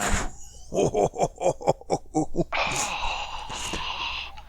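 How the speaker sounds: high, hooting laugh; long adrenaline laugh. Real, not acted. Player giddy with adrenaline after a roll, laughing.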